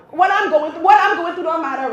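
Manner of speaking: loud, worked-up mock outrage; indignant mother. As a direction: protest loudly at being ignored. Mother indignant at son's request.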